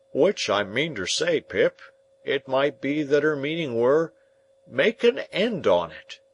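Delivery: earnest country smith; Joe the blacksmith. Acted. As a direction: puzzle it out like plodding Joe.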